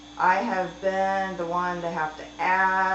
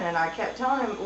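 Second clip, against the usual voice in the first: ordinary talk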